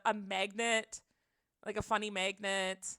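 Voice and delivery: ordinary talk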